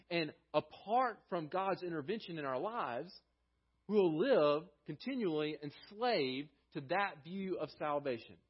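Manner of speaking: ordinary talk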